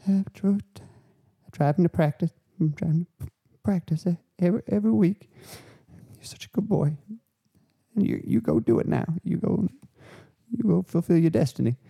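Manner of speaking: high-pitched hammed-up Southern mum voice; mock Southern mum. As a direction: play a doting Southern mother, way up high. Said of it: "they've gone Southern".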